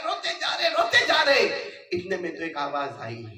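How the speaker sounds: loud, high, driving sermon narration; impassioned sermon; full-force preaching. Acted. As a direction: paint the caravan scene for the crowd at full voice. Preaching the caravan scene.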